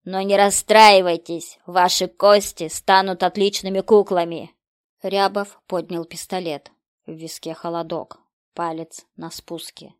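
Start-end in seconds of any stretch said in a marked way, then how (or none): 0.0-4.5 loud mock consolation; gloating villain. A narrator's reading. Killer to the villagers by loudspeaker, loud gloating taunt. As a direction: taunt them at full volume.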